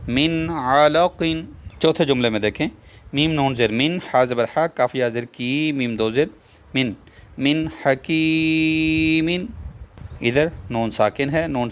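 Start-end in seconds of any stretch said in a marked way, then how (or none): 0.1-1.2 slow drawn recitation of من علق, higher, the نون clear and not nasalised. Shown as a demonstration. Teacher showing the class izhar with slow recitation. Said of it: "ادھر بھی غنہ نہیں کریں گے". 7.4-9.3 من حکیم drawn out slowly and higher, the نون said clearly without ghunna; slow recitation. Shown as a demonstration. Teacher showing students izhar with a slow recitation. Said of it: "تو ادھر بھی اظہار ہے".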